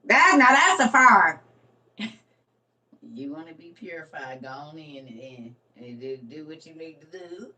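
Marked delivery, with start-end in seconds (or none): 3.1-7.5 much softer, trailing voice; almost to yourself; hushed aside. Real, not acted. To the viewers, quietly telling them to just do it.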